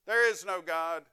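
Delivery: loud, scornful yell next door. Retold or imitated. Atheist neighbour yelling at the old lady, loud mock yell. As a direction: bellow it across at her. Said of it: "yell after her".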